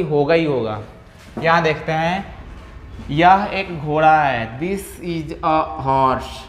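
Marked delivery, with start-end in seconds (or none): 3.1-6.4 this is a horse said very slowly; slow model. Shown as a demonstration. Teacher introducing the next sentence slowly to students. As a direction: read it out slowly for learners. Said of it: "teacher models new sentence".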